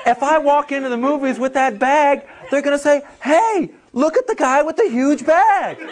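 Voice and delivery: high, whiny mock complaint; hammed-up neurotic whine; Seinfeld-style rant. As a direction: do a fussy comedian rant about the bag.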